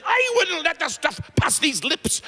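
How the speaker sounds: high, prim refusal; indignant nun. Acted. Pious nun refusing the drink, high and scandalized. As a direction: play the pious sister, scandalized.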